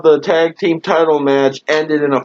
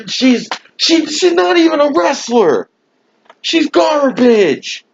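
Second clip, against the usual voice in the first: held-back yell; 9 semitones higher than usual. Real, not acted. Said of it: "I'm trying not to yell too loud".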